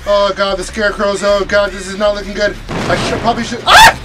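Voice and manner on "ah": sudden high yelp of fright; startled scream. Real, not acted. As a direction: jump and scream. Jumpscare while playing.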